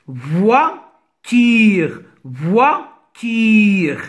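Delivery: Voiture cut in two, voi, then ture, with a pause between; syllable by syllable. Shown as a demonstration. Teacher cutting words for pupils, Voiture in two separated syllables. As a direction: break Voiture into two separate beats, first rising, then falling. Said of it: "Je découpe les mots".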